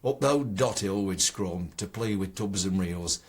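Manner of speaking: broad Ilkeston poem recital; Ilkeston dialect. Shown as a demonstration. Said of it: "written in the Ilkeston dialect".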